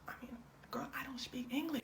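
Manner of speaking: soft, breathy whisper; whispered reply. Retold or imitated. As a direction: hush it like a confused aside. Family answering confused Lindsay.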